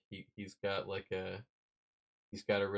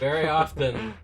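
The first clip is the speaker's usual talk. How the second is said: said louder to be heard; louder repeat; 6 semitones higher, 12 dB louder than usual. Real, not acted. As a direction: raise your voice over the laughter. Repeats louder after interruption.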